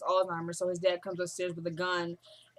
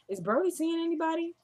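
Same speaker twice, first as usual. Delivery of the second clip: higher-pitched mimicry of the returning ex; mock girlish inquiry; 9 semitones higher than usual. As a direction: imitate her asking, voice lifted high. Mimics Annie returning to town.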